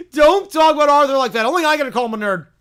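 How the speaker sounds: put-on loud, indignant kid outburst; mad little sister. Sister defending Arthur to callers, angry. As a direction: shout in defense of a brother. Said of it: "she's mad".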